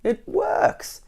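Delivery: high amazed discovery; wonderment. A narrator's reading. Mister Jelly marvels that counting worked, high. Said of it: "said out loud in wonderment".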